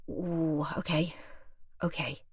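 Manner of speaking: soft, halting retold murmur; stunned. Shopper absorbing the lady's news, softly stunned. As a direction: take it in softly, pausing between words.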